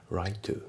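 "Right to" as rai-tu with a single T; dropped T. Shown as a demonstration. Teacher showing learners the silent T in Right, dropped T. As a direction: run "Righ-" straight into "to". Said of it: "ライトのTが抜けますね".

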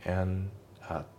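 AND AT as "an-at"; dropped D. Shown as a demonstration. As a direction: link the N of AND into AT. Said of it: "andのDが抜けますね".

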